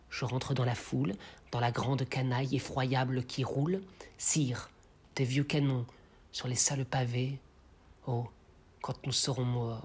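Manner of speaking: grave, hushed verse in short phrases; poem recitation. Acted. The blacksmith speaks of death to the king, hushed declamation. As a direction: speak the lines low and soft, with pauses.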